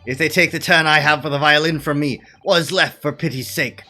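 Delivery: loud lament. Acted. Continuing lament.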